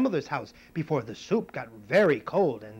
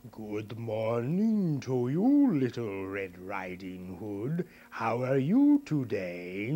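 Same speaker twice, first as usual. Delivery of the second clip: slow, sly wolf voice; big bad wolf; 3 semitones lower, 4 dB louder, much slower than usual. Wolf greeting the girl in the woods, slow and sly. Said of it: "and he said".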